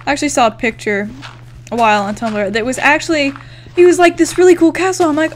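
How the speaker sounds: ordinary talk, nothing marked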